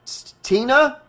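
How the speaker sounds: high, stuttered, rising question; stammering. A narrator's reading. Nick searches with the gun up, nervous stammer. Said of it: "he stammered".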